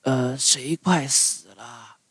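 slow, soft, twangy fish voice; a slow, odd, out-of-tune fish voice; out-of-tune guitar voice. A narrator's reading. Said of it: "像走了调的六弦琴似的声音".

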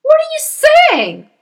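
slow, very high, loud cry; husband crying out. Acted. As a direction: cry it aghast. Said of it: "the husband cried".

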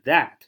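THAT said hard and loud; strong full-form "that"; stressed THAT. Shown as a demonstration. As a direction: punch the word "that" with its full vowel. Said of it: "那个重读是没问题的".

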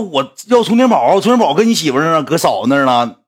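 high insistent fan voice; high, pushy demand; annoyed fan. Retold or imitated. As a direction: mimic the fan demanding back. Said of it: "他说".

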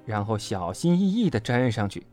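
ordinary delivery, nothing marked

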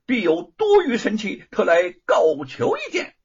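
confident high-pitched petitioner; Monkey King. A narrator's reading. Monkey King asking the Dragon King for a weapon, confident high voice. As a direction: hold a high, declaiming character voice.

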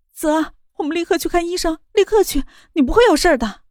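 high, tearful, desperate urging; crying. Acted. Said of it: "他哭着道". (哭着恳求)

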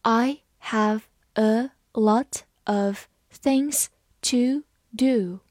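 slow, stretched-out model reading; read slowly. Shown as a demonstration. Teacher reading slowly so students can follow. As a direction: draw each word out for learners. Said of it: "好，慢慢来读".

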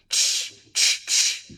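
voiceless hissing steam noise. A narrator's reading. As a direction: puff out steam from the ears. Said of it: "耳朵里喷着蒸汽". (模仿喷蒸汽的嗤嗤声)

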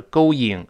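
"going" as bare "go-ing", no w between vowels; without the glide. Shown as a demonstration. Teacher shows what to avoid, going without glide. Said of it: "而不是going".

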